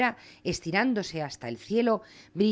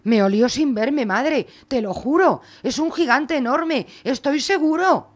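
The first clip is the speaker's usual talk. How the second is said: put-on scared insisting boy; 6 semitones higher, 5 dB louder than usual.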